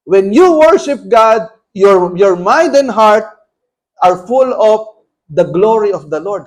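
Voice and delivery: loud, slow, emphatic preaching; forceful preaching. Acted. Preacher exhorting the congregation, loud and slow. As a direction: drive each word home slowly.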